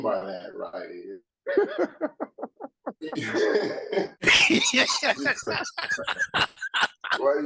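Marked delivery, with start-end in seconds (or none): 1.5-7.1 high burst of laughter; cracking up hard. Real, not acted.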